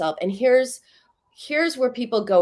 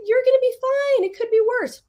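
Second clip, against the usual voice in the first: much higher, bright brush-off reassurance; dismissive mom; 4 dB louder, faster than usual. Retold or imitated. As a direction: chirp it away as if it's nothing. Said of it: "Totally dismissive".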